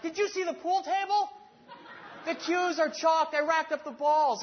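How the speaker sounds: high, loud, laughing mock brag about the pool table; oblivious husband showing off his chores, high and loud; proud defensive boast. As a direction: ham up your own cluelessness, slow and pleased. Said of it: "Because I was like".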